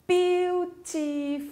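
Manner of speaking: "Beautiful" broken into three slow, high syllables; teacher counting out the syllables of "Beautiful". Shown as a demonstration. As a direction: sound out "Beautiful" slowly, one held syllable at a time. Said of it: "Olha, são três sílabas".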